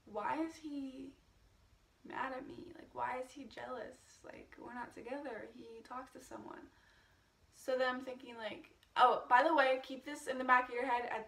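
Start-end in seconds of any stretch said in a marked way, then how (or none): 0.1-6.7 inner musing. Retold or imitated. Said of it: "thinking in my head".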